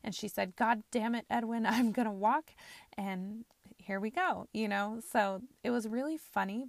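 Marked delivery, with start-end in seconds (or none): 0.6-2.5 loud and firm, imitating grandma putting her foot down; retold forceful outburst. Grandmother insisting to her husband she will walk, loud and firm. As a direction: bark it out like a stubborn grandma who means it. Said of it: "she said it and she meant it".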